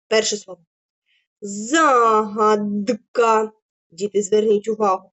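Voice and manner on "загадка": very slow, each syllable stretched; dictation drawl. Shown as a demonstration. Teacher dictating a word to the class. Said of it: "протягуючи кожний склад".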